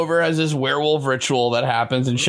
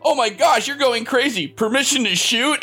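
higher, hammed-up cop alarm; mock alarmed cop; 9 semitones higher than usual. Friend imitating the panicky cop radioing for permission, mock alarm. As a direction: play the jumpy cop about to shoot. Cop reacts to crazy man.

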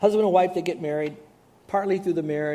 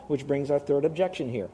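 ordinary delivery

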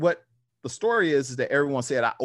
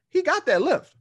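crowd shock; 13 semitones higher, 5 dB louder than usual. Retold or imitated. Crowd reacting to surprise lift.

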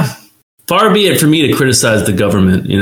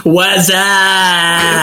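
Whassup commercial; 7 semitones higher than usual. Retold or imitated. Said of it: "Budweiser Was Up commercial".

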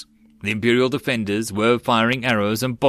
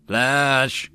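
lightly raised answering call; called-back code word; 3 semitones higher than usual. A narrator's reading. Sergeant answering the code word, calling back. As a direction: call back with mild force. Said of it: "called back an answering the code word".

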